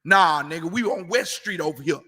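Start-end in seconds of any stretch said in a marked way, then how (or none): none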